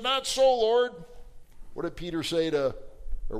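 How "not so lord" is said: loud, high protest to the master's face; defiant outburst. Acted. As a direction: shout back at Jesus in defiance. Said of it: "Peter said".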